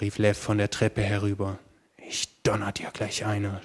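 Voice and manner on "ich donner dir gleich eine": ordinary delivery, nothing marked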